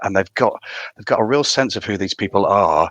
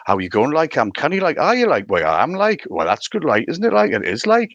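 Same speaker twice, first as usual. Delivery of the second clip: lilting Geordie chat, pitch leaping high; playful Geordie demo, swooping up on the endings; Geordie accent; 6 semitones higher than usual. Shown as a demonstration. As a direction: show off Geordie with "like" tagged on every phrase. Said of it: "if you're gonna say it".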